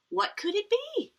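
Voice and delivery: high wondering question; a high, puzzled wonder; curious. Acted. Mother wonders at smell.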